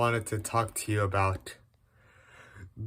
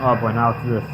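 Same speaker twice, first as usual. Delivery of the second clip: ordinary delivery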